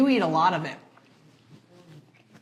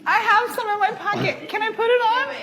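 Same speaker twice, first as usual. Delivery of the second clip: laughing; 10 semitones higher than usual. Real, not acted. Giggling through spicy pain.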